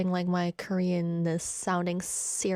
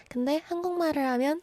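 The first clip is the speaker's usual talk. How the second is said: pitched up, lilting Korean; higher Korean voice; 6 semitones higher, much faster than usual. Shown as a demonstration. Host showing how her tone goes up speaking Korean, higher. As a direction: lift the voice up high for the Korean. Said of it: "I go up when I'm speaking Korean".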